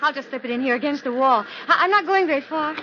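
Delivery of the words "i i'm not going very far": ordinary delivery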